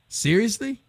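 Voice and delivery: a high, disbelieving "seriously?"; incredulous. Real, not acted. Ranting to his co-host about the movie, incredulous and pitched high. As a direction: throw the question up in disbelief.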